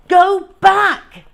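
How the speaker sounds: high, loud desperate yell. Acted. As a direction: shout it with all you've got. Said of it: "Bertie yelled".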